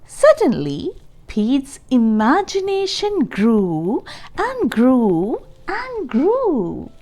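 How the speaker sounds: ordinary delivery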